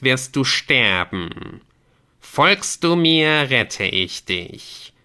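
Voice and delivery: very slow, weighty ultimatum; ominous game messenger. Acted. Messenger offers doomed Squamanto rescue, ominous. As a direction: draw out each phrase menacingly.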